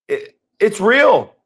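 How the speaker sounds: ordinary delivery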